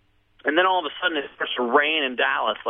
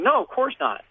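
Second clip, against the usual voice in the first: high-pitched mock denial; protesting denial; 11 semitones higher than usual. [name] answers friend's question about drugs, protesting denial. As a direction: imitate an innocent protest, voice way up.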